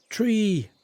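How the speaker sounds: "Tree!" with a hard t, no th sound, called out high; Irish-style "Tree!" shouted; mock Irish three. Shown as a demonstration. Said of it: "tree is how Irish people say it".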